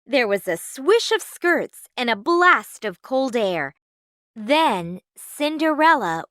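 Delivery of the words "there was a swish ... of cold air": plain reading voice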